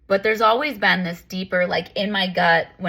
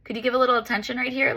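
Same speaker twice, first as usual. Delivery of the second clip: higher, quick little plea from the body; a lightly voiced body asking for attention, higher and quick; body's gentle plea; 6 semitones higher than usual. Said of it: "It's just your body saying".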